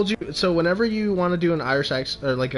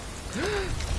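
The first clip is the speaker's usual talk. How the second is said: sharp high gasp; 6 dB quieter than usual. Real, not acted. Shocked she doesn't know Geralt.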